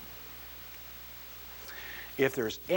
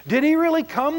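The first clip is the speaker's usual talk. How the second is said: high, loud eager question; the alien can hardly contain itself; excited; 14 semitones higher, 9 dB louder than usual. Acted. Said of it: "the alien said excitedly".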